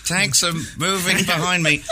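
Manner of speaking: high, slow dramatic reporter voice; hammed-up frontline report; BBC war correspondent. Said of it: "he's like".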